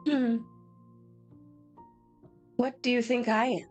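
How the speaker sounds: ordinary talk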